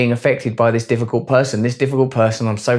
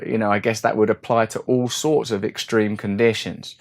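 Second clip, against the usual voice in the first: ordinary delivery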